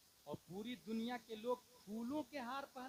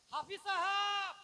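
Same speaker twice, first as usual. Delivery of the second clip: high, loud, drawn-out hail; stretched, ringing address; calling out; 8 semitones higher, 9 dB louder, slower than usual. Retold or imitated. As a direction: call the title out slowly to someone far away. Calling out the title again.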